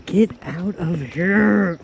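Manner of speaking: slow, high, drawn-out amazement; high, slow gasp of wonder; delighted disbelief. Real, not acted. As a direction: stretch it out, awed. Delighted at pretty sky.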